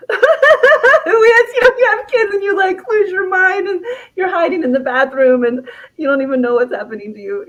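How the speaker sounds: high, fast, half-laughing overwhelmed mom; frazzled mother. Retold or imitated. Women listening voiced as frantic mothers, high and fast. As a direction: blurt it out like a mom at her wits' end. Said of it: "women listening who are like".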